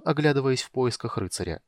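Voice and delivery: plain reading voice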